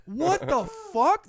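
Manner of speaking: high, slow, incredulous; retold disbelief. Retelling seeing a star in shades at night, incredulous. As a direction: draw the words out in amazed disbelief. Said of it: "I was like".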